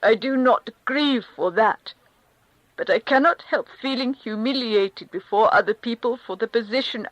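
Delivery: high, loud, strained protest; humiliated Karenin. Acted. Karenin confessing shame to the Countess, high and loud. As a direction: insist he can't help it, rising.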